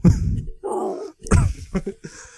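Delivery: squeaky giggle; high breathy laugh. Real, not acted. Host cracks up.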